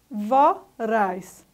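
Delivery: VORAZ split into two separate sound bursts; separação silábica. Shown as a demonstration. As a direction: say Voraz syllable by syllable, with a gap. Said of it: "dois conjuntos sonoros". (Voraz, pausadamente, sílaba por sílaba)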